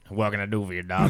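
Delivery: a homey diner mom greeting a customer; diner waitress mom. Retold or imitated. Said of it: "supposed to be somebody's mom".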